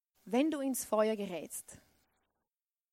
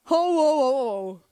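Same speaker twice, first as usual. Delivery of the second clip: booming mock villain laugh; loud hearty ho ho ho; 9 dB louder, slower than usual. Preacher hams up the raging king's laugh.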